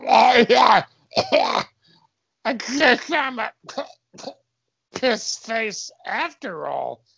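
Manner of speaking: high, slow drowning voice; hammed-up gurgle as if drowning; gurgling, choking. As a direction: choke the words out through liquid. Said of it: "Cop says in a gurgling, choking voice".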